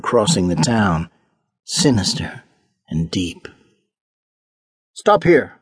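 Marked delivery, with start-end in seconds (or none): 4.9-5.5 sharply raised, higher call; shouted order. A narrator's reading. Nobleman ordering his carriage driver to halt, shouting. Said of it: "South shouted to the driver".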